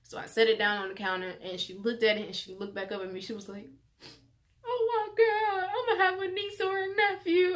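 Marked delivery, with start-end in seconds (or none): 4.6-7.6 much higher, squealing with joy; excited sister. Retold or imitated. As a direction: sister thrilled about an aunt role. Sister excited about niece.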